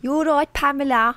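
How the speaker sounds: failed Brummie attempt. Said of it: "sound South African".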